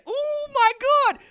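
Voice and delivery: very high, slow squeal of delight; squealing oh my God. Real, not acted. Fan overwhelmed by the Avengers, high squeal. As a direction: let it squeal out with joy. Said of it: "I was so excited".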